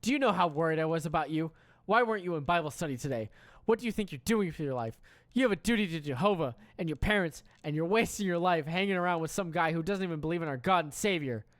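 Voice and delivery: lightly acted angry text; a hint of a righteous scold; mild mock scolding. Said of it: "I get mad and text".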